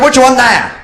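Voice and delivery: high, loud retort; irritated shouting. Acted. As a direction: shout back at a deaf old man.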